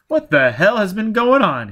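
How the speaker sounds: loud, high-pitched indignant demand. A narrator's reading. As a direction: demand an explanation forcefully. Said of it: "he demanded".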